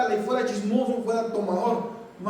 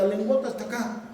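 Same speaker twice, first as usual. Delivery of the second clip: ordinary delivery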